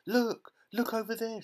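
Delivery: very high mock scream; excited scream. A narrator's reading. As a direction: cry out frantically to point something out. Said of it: "she screamed".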